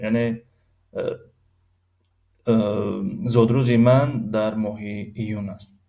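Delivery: ordinary talk, nothing marked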